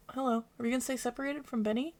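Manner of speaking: ordinary talk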